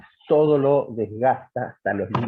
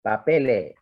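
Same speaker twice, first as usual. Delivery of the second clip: papele with Andalusian lengthened final vowel, no s; Andalusian accent; 2 semitones lower, slower than usual. Shown as a demonstration. Professor imitating Andalusian papele, dropped s. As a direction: drop the s Andalusian style in papele. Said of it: "el andaluz dice papele".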